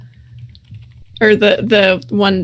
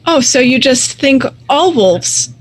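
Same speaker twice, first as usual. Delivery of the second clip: lightly voiced indignant wolf leader; offended pack leader; 4 semitones higher than usual. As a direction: challenge them, pitch rising as you go.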